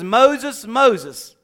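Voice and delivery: loud call across the desert; calling name. Acted. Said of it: "a voice cried out".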